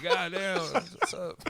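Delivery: high, squeaky mock priest greeting; a goofy high priest voice, said lightly. Imitating the priest greeting him at church, high and goofy. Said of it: "Father Murphy is just like".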